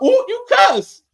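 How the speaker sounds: high, drawn-out teasing "ooh"; mock scandalized. Viewer catches the host swearing, mock scandalized. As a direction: tattle in a loud, high singsong. Teasing someone caught cussing.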